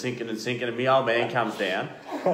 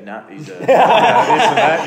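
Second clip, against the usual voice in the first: dad's dismissive line, cracking up higher and louder; laughing impression of Dad; 6 semitones higher, 11 dB louder than usual. Telling the interviewer what his father said, laughing. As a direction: quote Dad while laughing through it. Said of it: "And he goes".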